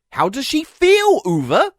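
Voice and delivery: pointed, frustrated question; exasperated question. Acted. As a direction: demand an answer, not yet yelling. First asking of the repeated demand.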